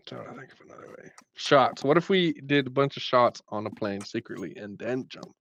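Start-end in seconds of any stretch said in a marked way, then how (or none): 0.1-1.1 fast, whispered aside to himself; muttering to himself. Real, not acted. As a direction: mutter it under your breath, quickly. Mutters to himself while thinking.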